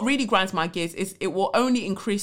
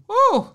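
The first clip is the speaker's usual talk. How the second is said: short, high, loud "ooh"; sudden high-pitched reaction to exhausting news; exclaimed ooh; 8 dB louder than usual. Real, not acted. Worn out by the letter.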